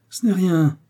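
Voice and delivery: ordinary delivery, nothing marked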